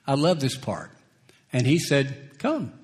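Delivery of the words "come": voicing Jesus. Preacher voices Jesus calling Peter.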